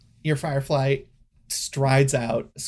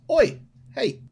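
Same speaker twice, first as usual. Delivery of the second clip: high-pitched, louder crocodile call-out; crocodile gatekeeper; 8 semitones higher, 5 dB louder, faster than usual. Acted. Crocodile ticket-taker hails a lurking visitor, high voice calling out. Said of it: "the crocodile looks over and he goes".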